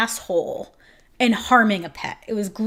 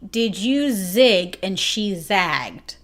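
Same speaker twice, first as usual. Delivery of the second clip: slow quote; much slower than usual. Retold or imitated. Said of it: "she says to me".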